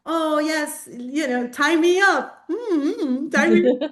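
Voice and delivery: lightly voiced keen old lady; imitating eager lady.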